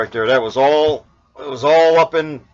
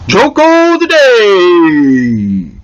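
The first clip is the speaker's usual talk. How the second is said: slow, drawn-out announcer call of "Joko of the day"; booming, stretched-out title card call; segment title announcement; 3 semitones higher, 7 dB louder, much slower than usual. Shown as a demonstration. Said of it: "Let's get on with some Joko of the day".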